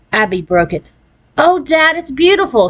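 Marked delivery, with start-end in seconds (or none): 1.4-2.7 high-pitched gushing delight; thrilled and pitched up, not shouted; delighted cry. A narrator's reading. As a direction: gush with admiration. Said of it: "she cried".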